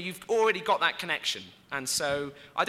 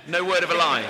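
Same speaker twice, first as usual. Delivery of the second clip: normal talking voice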